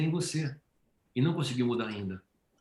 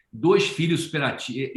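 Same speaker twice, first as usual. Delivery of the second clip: usual speaking voice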